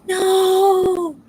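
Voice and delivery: loud high dismayed no; mock outraged no. To husband squeaking the toy, a loud high protesting no. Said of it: "I'm like".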